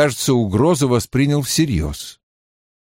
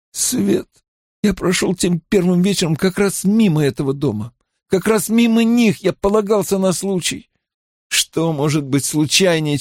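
higher-pitched, dismayed outburst; agitated character voice, slowing into the curse; exasperated Mat; 6 semitones higher than usual. Acted. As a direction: marvel bitterly at your missed chance. Narrator voices Mat, frustrated at luck.

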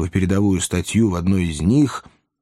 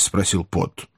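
usual speaking voice

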